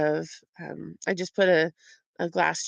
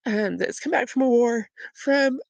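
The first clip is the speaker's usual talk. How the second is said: near tears; 6 semitones higher than usual. Real, not acted. Mother telling viewers about her son, near tears. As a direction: voice rising and catching, close to crying. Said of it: "I'm gonna cry".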